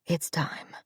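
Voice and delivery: soft and final; quiet resignation. Acted. Dying man to Gideon, hushed. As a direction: let it go gently.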